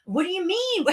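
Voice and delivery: ordinary talk, nothing marked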